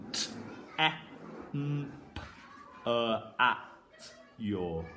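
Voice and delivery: each sound said apart. Shown as a demonstration. Teacher sounding out the word for pupils. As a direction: sound out T, e, m, p, e, a, ch, yor one by one. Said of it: "I'll go through it slowly".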